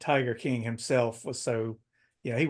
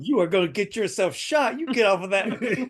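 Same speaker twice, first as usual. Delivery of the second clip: higher, louder mock warning; imagined scolding voice; 7 semitones higher, 6 dB louder than usual. Voicing what she was thinking, mock scolding. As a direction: warn him off in her voice. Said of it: "That's what she was thinking".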